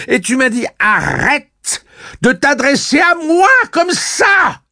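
very high, loud tirade; furious yelling. A narrator's reading. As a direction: scream the reproach at full voice. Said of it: "hurla tante Cord".

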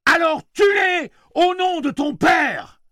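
slow, high, very loud shout; roared order. A narrator's reading. Gunslinger roaring at Susanna to shoot, full shout. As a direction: bellow the command to kill. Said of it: "hurla Roland".

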